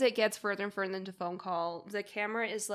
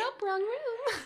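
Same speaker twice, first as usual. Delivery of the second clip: high, drawn out nope; airy dismissive woman; high slow woman voice; 14 semitones higher, much slower than usual. Retold or imitated. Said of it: "And she's like".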